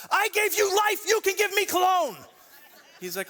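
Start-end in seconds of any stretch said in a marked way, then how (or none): 0.1-2.2 high, loud mock-angry snap at his son; hammed-up angry father, loud and high; snapping back. Dad snapping back at his son over cologne, loud mock anger. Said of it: "I snapped back at him".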